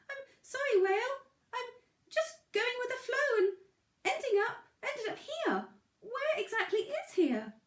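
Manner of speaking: a tiny stone, a bit flustered; small pebble voice. A narrator's reading. Pebble character speaks.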